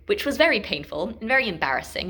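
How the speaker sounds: ordinary delivery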